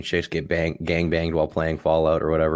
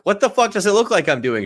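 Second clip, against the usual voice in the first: high, exasperated mock yell; acted-out pissed-off retort; retold angry outburst; 12 semitones higher, 3 dB louder than usual. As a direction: snap it back, voice shooting up in indignation. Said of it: "I was so pissed off".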